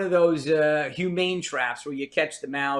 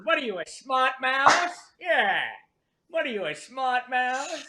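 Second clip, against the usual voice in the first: higher-pitched old movie gangster impression; mock tough-guy gangster voice, pitched up; Edward G. Robinson; 7 semitones higher than usual. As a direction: play a wisecracking screen mobster. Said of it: "like she's Edward G. Robinson".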